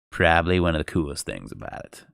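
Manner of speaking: usual speaking voice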